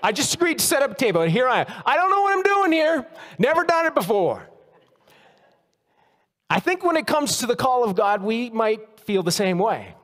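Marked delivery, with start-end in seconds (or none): 0.0-4.5 sermon story, overwhelmed volunteer exasperated; exasperated outburst. Retold or imitated. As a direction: blurt out the helpless excuse. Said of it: "I wanted to scream out".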